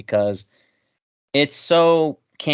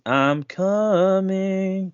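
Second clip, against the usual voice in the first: mock breathy line; 6 semitones higher, much slower than usual. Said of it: "all I can think about with this movie is".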